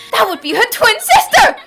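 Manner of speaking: high, loud, triumphant announcement; dramatic reveal. Acted. Detective reveals the doppelganger is a twin sister, triumphant. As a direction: land the deduction loud and high.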